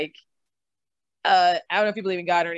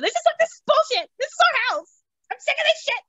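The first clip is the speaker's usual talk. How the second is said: shrill, much higher bratty outburst; tantrum. Retold or imitated. At the house showing in front of fiancé, high whiny tantrum. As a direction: stamp your feet and whine it out high. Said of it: "just threw a tantrum".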